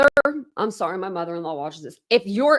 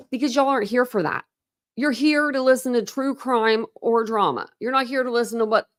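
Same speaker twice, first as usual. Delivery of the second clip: ordinary talk, nothing marked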